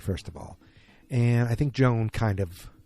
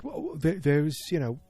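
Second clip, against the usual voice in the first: Cockney accent attempt; 6 semitones higher than usual. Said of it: "a real Cockney accent".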